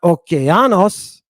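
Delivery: "okeanos" with the weight on the "a"; stress on third syllable. Shown as a demonstration. Teacher showing another wrong accent, third syllable stressed. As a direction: lean on "a" in "okeanos". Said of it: "and it's not".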